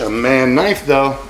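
usual speaking voice

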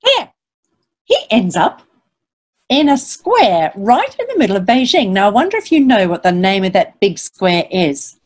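ordinary delivery

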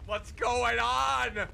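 loud, slow, high outcry; alarmed shout. Real, not acted. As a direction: demand it in alarm. Shocked by burning nightmare.

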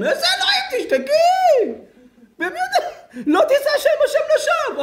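high, loud shrieking rebuke; comic screeched telling-off; mock furious scolding. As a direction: shriek it like an outraged rabbi. Acted scolding of woman swearing.

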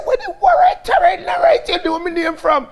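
high, loud, laughing woman's voice; woman's laughing reply. Retold or imitated. The woman with the knife laughing at him, high and loud.

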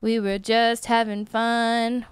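subdued, mournful lament; sadness. Pretend. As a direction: say it dejectedly, drawing out the words. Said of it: "The sadness is real".

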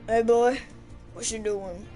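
ordinary talk

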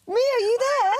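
high, excited call down the phone; eager high question. Real, not acted. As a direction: call for a loved one, pitched high. Calling for Mia on phone.

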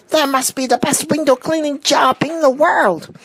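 very high, squawky bird voice; thrilled cartoon pelican; excited pelican cry. A narrator's reading. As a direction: squeal it all at a high pitch. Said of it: "cried the pelican".

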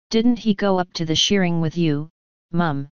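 ordinary delivery

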